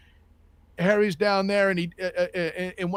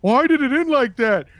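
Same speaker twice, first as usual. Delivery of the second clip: whiny moviegoer; 6 semitones higher, 6 dB louder than usual. Retold or imitated. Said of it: "people that went to the first ... was like".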